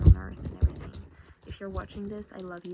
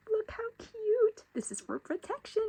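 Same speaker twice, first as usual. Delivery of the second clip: very high, cooing little voice; cutesy squeal; 14 semitones higher, 4 dB louder than usual. Real, not acted. Showing viewers a cute protective thing, high cutesy voice. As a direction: coo over something adorable, way up high.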